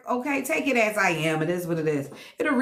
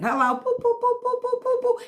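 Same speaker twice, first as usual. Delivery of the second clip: squeaky boop boop boop, far up; silly chirped boops; high playful boops; 14 semitones higher than usual. Pretend. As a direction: bop the words like a cartoon beep. Playful sound effect.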